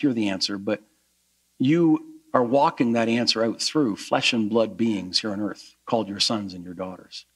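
hushed congregational prayer, falling away at phrase ends; earnest prayer. Real, not acted. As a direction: speak to God humbly and sincerely. Leads the closing prayer.

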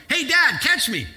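high, loud call from above; child calling out. Retold or imitated. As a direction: shout it down like a kid mid-jump. Son yelling from cliffs.

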